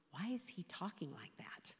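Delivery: soft anxious whisper; worried whisper. Retold or imitated. Widow whispering her daughters' worried question. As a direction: hush it like a frightened daughter.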